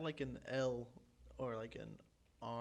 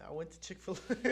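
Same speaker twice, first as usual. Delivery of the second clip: higher, louder, breaking into laughter; laughing; 7 semitones higher, 7 dB louder than usual. Real, not acted. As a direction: struggle to start the story through giggles. Cracking up while starting a story.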